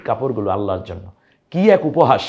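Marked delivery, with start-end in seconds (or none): 1.5-2.2 louder, higher cry of dismay; dismayed outcry. Real, not acted. Teacher lamenting a wrong recitation, loud dismayed outcry. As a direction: exclaim the mockery of it. Teacher decrying a grave mistake.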